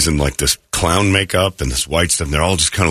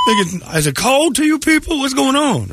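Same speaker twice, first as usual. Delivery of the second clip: high-pitched imitation of a baffled fan; mock incredulous. Retold fan's incredulous question.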